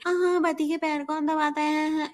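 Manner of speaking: high, fast mocking quote; mock sing-song. Refusing to be the obedient wife, high mocking sing-song.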